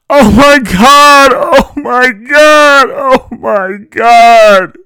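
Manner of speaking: very high, drawn-out panicked screaming; shouting full lung. A narrator's reading. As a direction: scream it slowly at the top of your lungs. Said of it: "shouting at the top of her lungs".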